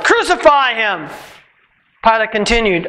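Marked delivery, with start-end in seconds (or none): 0.0-1.1 mild raised cry of the crowd; a touch of mob shouting. A narrator's reading. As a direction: call it out as an angry mob, lightly. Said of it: "The crowd shouted".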